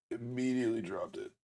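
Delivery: soft, deflated under the breath; quiet. Real, not acted. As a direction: mutter it, let down. Repeats mishap quietly, dejected.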